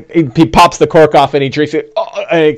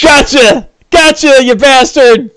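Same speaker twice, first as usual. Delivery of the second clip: much higher triumphant yell; gleeful villainous taunt; gloating orc; 10 semitones higher, 3 dB louder, slower than usual. Acted. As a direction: crow over a successful hit. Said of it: "He goes".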